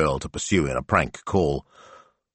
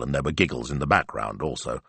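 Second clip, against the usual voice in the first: plain reading voice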